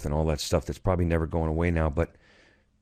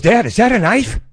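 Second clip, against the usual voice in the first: put-on high, loud child's question; kid voice; 14 semitones higher, 10 dB louder than usual. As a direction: squeak it like an excited kid. Son's question voiced in story.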